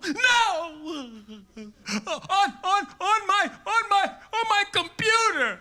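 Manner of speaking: put-on high, loud blubbering kid; crying kid. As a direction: wail it like a sobbing schoolboy.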